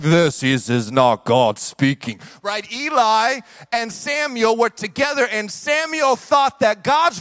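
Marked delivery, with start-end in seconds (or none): 0.0-2.2 very deep, slow divine voice; mock voice of God. Preacher showing how God doesn't necessarily sound, mock deep God voice. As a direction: boom it low and drawn out. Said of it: "doesn't necessarily sound like".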